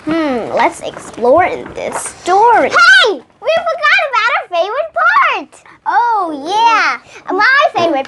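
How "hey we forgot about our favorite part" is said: high, fast burst of surprise; suddenly remember something exciting; bright exclaim, much higher and quick; sudden excited reminder. Real, not acted. Sudden reminder while reading.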